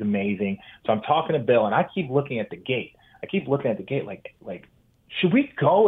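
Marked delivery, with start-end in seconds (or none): 5.2-5.9 anxious high question. Retold or imitated. Anxious at the gate.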